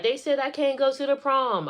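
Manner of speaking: high-pitched imitation of an upset daughter; retold wail, without real sobbing; tearful protest. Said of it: "She just start crying".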